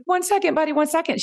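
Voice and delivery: put-on fobbing off a child who wants attention; distracted mom to little boy. Mom putting off her little boy, high sing-song brush-off. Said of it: "And I'm like".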